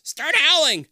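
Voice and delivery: high, loud panic; panicked shout. A narrator's reading. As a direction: yell it in fright. Todd panics.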